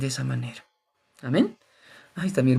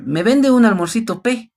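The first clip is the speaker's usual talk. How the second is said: higher, louder mock Peruvian customer asking for lunch; a light Peruvian accent, asking sweetly; 9 semitones higher, 6 dB louder than usual. Preacher playing a Peruvian customer buying lunch, mock Peruvian accent. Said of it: "y noto su acento".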